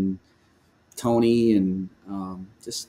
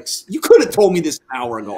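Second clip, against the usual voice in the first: annoyed complaint; 4 semitones higher, 5 dB louder than usual. Retold or imitated. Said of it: "I was so mad".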